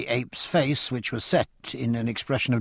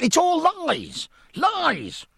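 a much higher, slow, furious cry; passionate cry; much slower than usual. Acted. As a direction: hurl the denial in a rage. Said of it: "cried Rogers".

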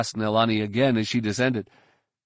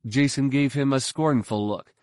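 usual speaking voice

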